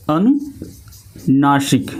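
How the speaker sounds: अनुनासिक drawn out slowly with nasal vowels; nasal demo. Shown as a demonstration. Teacher demonstrating the nasal sound of अनुनासिक to students. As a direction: stretch अनुनासिक, let it ring through the nose. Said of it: "अनुनासिक जो वर्ण है".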